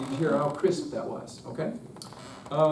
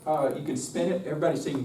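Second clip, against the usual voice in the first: usual speaking voice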